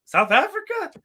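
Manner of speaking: sudden very high voice; squeaky high question. Retold or imitated. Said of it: "He's like".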